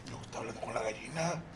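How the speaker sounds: very soft whispered aside. Retold or imitated. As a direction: whisper like you're spying. Rancher spying in a whisper.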